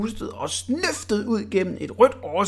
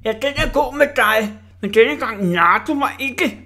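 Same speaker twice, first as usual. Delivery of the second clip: mocking bully; 6 semitones higher, 6 dB louder than usual. Orla the bully mocks.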